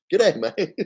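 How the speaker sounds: put-on lightly done Australian accent, breaking into laughter; Australian Doctor Spock. Streamer imagines an Australian Spock greeting, light Australian accent. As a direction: joke Australian greeting, giggling through it. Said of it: "an Australian Doctor Spock".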